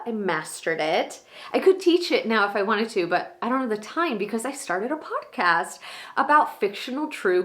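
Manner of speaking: gushing, excited and proud, pitch leaping high; Maura, high on life. Acted. Monologue actor as Maura catching up with Danny, high on life. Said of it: "so high on life".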